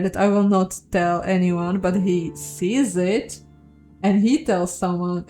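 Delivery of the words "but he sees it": plain reading voice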